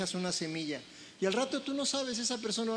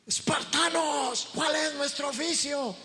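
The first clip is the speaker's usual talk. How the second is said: higher, louder, slow rallying shout; Leonidas battle cry; 7 semitones higher, 4 dB louder than usual. Retold or imitated. Leonidas shouts to his Spartan army, rousing battle cry. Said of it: "y grita el Leonidas".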